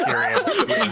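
laughing through the name; high laughing delivery. Real, not acted. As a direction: let the word break into a laugh. Laughing while naming.

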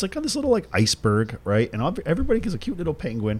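ordinary talk

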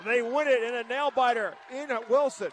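loud, excited game-end call; play-by-play call. Acted. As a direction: shout it like the final buzzer.

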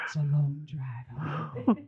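a mock-sultry slow whisper; playful whisper. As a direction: whisper it like a suggestive secret. Flirty joke about going home.

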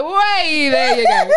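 high, loud whooping call; mock celebratory holler; playful cheer. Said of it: "I'll be like".

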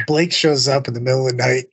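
ordinary talk, nothing marked

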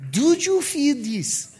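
put-on slow questioning French accent; French nurse accent. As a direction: ask slowly like a French nurse. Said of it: "She comes back with a French nurse".

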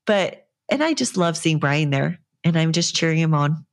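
ordinary delivery

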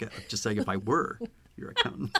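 low, soft voice to nobody; muttered aside. Retold or imitated. Imitating the accountant saying it out the window, quietly. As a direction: murmur it as if gazing out a window. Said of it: "he doesn't even say it too".

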